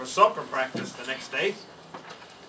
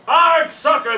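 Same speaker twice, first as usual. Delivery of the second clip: loud, much higher crew yell; 11 semitones higher, 10 dB louder, slower than usual. Acted. Pirate crew cheers for soccer, loud yell. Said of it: "the crew yelled".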